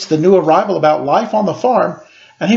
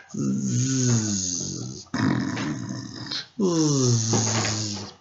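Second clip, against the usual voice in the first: snoring tractor; 6 semitones lower, 10 dB quieter than usual. Shown as a demonstration. Tractor falling asleep.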